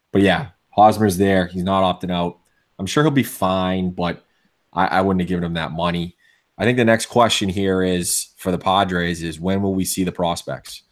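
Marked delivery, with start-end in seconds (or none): none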